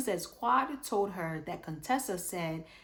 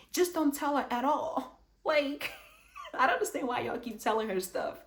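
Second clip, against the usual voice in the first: laughing exasperation; 7 semitones higher, 4 dB louder than usual. Real, not acted.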